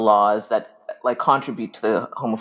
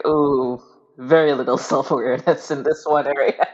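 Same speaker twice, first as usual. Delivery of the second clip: mock self-teasing; 3 semitones higher, 4 dB louder than usual. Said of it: "laugh at little naive baby [name]".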